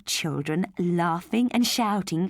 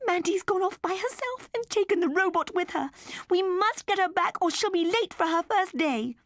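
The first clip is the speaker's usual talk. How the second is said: urgent; 13 semitones higher, 3 dB louder than usual. Acted. Mother calls urgently to husband.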